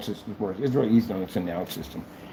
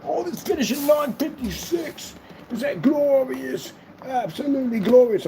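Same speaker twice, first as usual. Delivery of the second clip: very high, slow sing-song glee; mock grand delight; 12 semitones higher than usual. Celebrating the finished website in a high sing-song voice. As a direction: crow it like a delighted diva.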